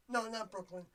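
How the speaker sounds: ordinary talk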